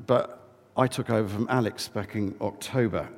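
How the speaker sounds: ordinary delivery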